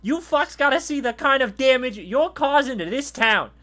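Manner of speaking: put-on high, loud kid's tirade; ranting boy. Boy cemented to the road berates guards, loud rant. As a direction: rant at them in a high boy's voice. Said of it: "he continues his, his, his rant".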